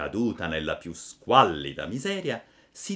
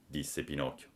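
ordinary delivery

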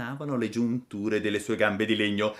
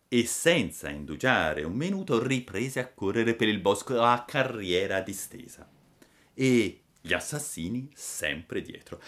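ordinary delivery, nothing marked

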